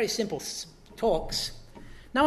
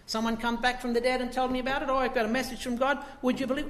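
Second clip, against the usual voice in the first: mock skeptic voice, higher and quick; 6 semitones higher, 3 dB louder, faster than usual. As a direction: sneer like a doubter hearing a ghost story. Preacher voices a skeptic mockingly.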